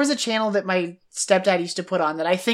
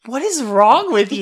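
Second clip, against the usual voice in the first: incredulous sister; 2 semitones higher, 4 dB louder than usual. Retold or imitated. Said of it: "was looking at me like".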